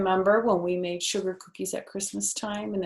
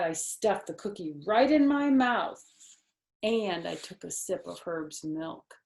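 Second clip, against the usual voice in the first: usual speaking voice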